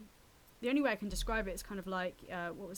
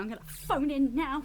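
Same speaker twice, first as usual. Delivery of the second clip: a hammed-up Northern accent, louder and higher; mock Northern accent; 5 semitones higher, 9 dB louder than usual. An actress mocking Northern casting calls with a hammed-up Northern accent. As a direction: send up the Northern voice casting directors want. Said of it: "You got all the Northerners going".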